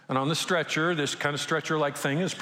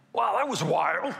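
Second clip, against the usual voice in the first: higher, amazed voice of the raised man; amazed risen man; 5 semitones higher than usual. Retold or imitated. The risen young man marvelling to the crowd, amazed. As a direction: play the dead man waking up amazed.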